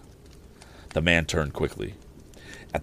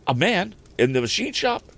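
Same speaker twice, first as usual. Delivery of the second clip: much higher, sudden surprised question; startled exclamation; 13 semitones higher, 3 dB louder than usual. Acted. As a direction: jump up at the news. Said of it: "exclaimed Tom".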